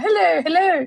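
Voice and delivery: high, put-on posh phone greeting; posh caller. She imitates a posh BBC boss calling. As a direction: mimic the posh man on the phone. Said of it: "this guy sounded really posh".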